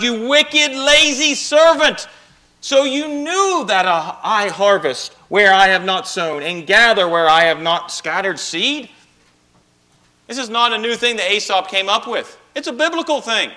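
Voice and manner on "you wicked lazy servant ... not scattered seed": higher, louder, slow stern rebuke; stern master. Acted. Master rebuking his lazy servant, stern scolding. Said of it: "His master replied".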